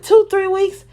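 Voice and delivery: high, loud disbelieving echo; alarmed inner voice. Retold or imitated. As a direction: shoot the words up in shock. Inner thought echoing.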